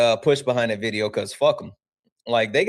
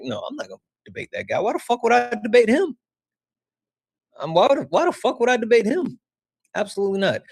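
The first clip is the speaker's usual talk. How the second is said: indignant refusal; 10 semitones higher, faster than usual. Retold or imitated. Refusing chat's request to debate, high and indignant. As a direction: scoff at the idea. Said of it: "I'm like".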